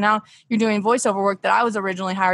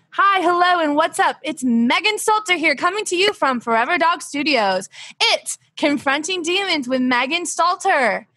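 bright, higher host greeting; host intro; 5 semitones higher than usual. Acted. Host greeting podcast listeners in an upbeat show voice.

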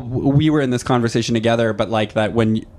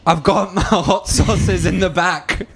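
slow, higher mock Scottish brogue; hammed-up Shrek-like Scottish accent; attempted Scottish accent; 6 semitones higher, much slower than usual. Someone presenting their fridge at a festival, faked Scottish accent. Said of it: "do a Scottish accent".

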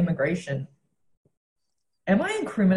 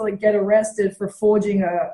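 normal talking voice

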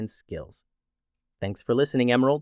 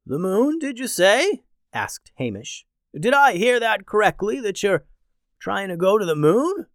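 intrigued Hamish; 7 semitones higher than usual. Acted.

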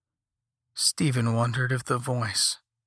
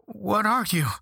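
afraid; 7 semitones higher than usual. A narrator's reading. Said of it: "Stephen asked through teeth chattering with fear".

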